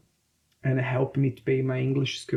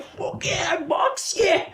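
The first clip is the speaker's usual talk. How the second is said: much higher, whooping "box, yeah"; rowdy mock shout; much slower than usual. Locals imagining noisy Brazilian fighters, mock shouting.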